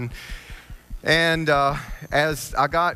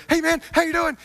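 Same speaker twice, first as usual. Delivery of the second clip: much higher, louder, overexcited greeting; squeaky overeager racer, pitched way up; Billy's greeting; 4 dB louder than usual. Retold or imitated. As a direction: play the hyper-energetic racer calling hello. Billy voiced in the story.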